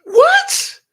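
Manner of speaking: high, loud incredulous what; shocked, almost laughing; stunned disbelief. Retold or imitated. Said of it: "I was like".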